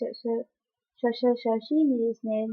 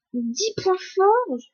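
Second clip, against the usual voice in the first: high, slow disbelieving question; surprised question; 6 semitones higher, much slower than usual. Real, not acted. Player amazed at the points, slow high surprised question. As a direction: stretch it out in disbelief.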